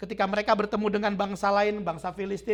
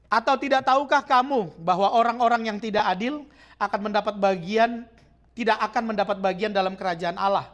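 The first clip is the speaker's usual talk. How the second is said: louder, solemn declaiming of the verse; scripture reading; 5 dB louder than usual. Acted. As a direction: proclaim the verse to the church. Reads scripture aloud.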